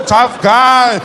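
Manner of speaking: loud, high, drawn-out jeer; imitated parental scorn; mock taunting parent. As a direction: taunt the child sarcastically.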